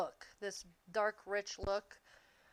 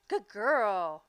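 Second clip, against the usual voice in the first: loud, drawn-out pet praise; dog praise; 2 semitones lower, 9 dB louder than usual. Real, not acted. Owner praises her dog for obeying, loud doggy praise. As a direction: praise the dog heartily, stretching the last word.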